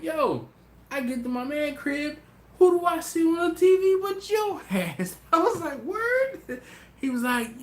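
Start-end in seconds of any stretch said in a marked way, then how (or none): 0.0-5.2 high, loud, excited buddy voice; excited friend. Retold or imitated. Friend tells host he saw him on TV, excited high voice. 5.8-6.6 surprised. Retold or imitated. Host reacts to news.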